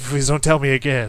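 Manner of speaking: fed-up laughing outburst; laughing exasperation. Real, not acted. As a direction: beg them to stop, laughing. Exasperated with repetitive lyrics.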